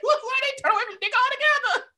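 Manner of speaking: very high squeal through laughter; laughing disbelief. Real, not acted. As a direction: squeal the question, cracking up at a friend. Still cracking up over the confession.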